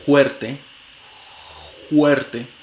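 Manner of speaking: "fuerte" said "huerte", f breathed out as h; glottal h for f. Shown as a demonstration. Teacher demonstrating the glottal f in fuerte. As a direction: turn the f of "fuerte" into a throaty h. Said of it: "como una glotal fricativa".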